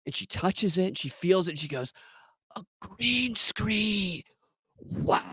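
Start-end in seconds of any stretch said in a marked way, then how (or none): 2.5-5.2 soft, very slow, awestruck; imitating a hushed wonderstruck agent; hushed awe. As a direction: gaze at it like a holy relic, barely breathing it. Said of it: "in awe".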